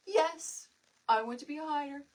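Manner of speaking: lightly voiced serious son declaring his choice. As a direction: play it like a solemn little boy. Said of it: "like, all, like, serious".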